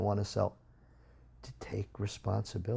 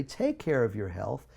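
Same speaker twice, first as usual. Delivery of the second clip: ordinary delivery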